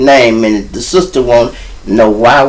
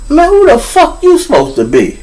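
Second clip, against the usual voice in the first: tough cop. Retold or imitated. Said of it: "he said".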